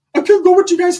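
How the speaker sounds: high whiny begging; Ricky's pleading kid voice. Retold or imitated. Ricky asking his tio to come along, high whiny voice. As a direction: beg like a little kid.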